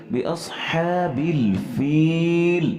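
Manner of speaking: drawn-out tarteel chant, each word held long; slow Quran recitation. Shown as a demonstration. Teacher reciting the verse slowly for students.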